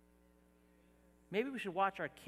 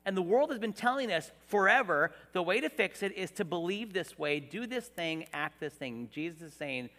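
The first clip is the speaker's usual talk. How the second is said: ordinary talk, nothing marked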